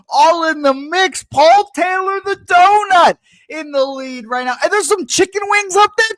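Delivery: high, loud, excited race call; race announcer. Acted. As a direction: call it like a horse race finish. Calls the food race.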